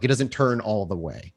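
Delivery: ordinary talk, nothing marked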